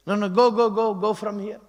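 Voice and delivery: loud, high stern voice; stern refusal. Retold or imitated. Strict Nanda Baba sending the devotee away, loud and stern. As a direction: drive them off firmly.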